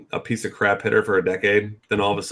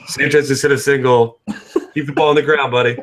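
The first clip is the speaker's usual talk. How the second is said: high, loud taunting heckler; heckling from crowd; 6 semitones higher, 4 dB louder than usual. Pretend. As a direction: needle him from behind the dugout. Imagined heckling in player's ear.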